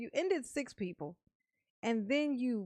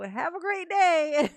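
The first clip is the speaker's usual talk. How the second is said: cheery yell out the window, higher and louder; mock yell; 8 semitones higher, 10 dB louder, slower than usual. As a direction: shout a fake-cheerful greeting. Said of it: "if you were yelling out the window".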